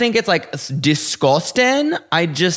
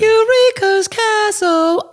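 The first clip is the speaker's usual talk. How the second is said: much higher, slow, stretched squeal; high drawn-out; 3 dB louder, slower than usual. Shown as a demonstration. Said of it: "she looks like Eureka".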